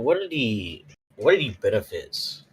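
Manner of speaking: ordinary talk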